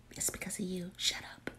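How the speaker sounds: hushed unvoiced whisper; whispered teasing. Real, not acted. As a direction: whisper a jab to the person beside you. Whispered teasing with someone nearby.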